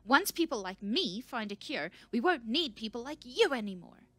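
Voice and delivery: high, loud anger; relentless tirade. A narrator's reading. Researcher won't stop berating the scout, furious tirade. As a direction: keep pressing, furious. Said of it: "but she didn't stop".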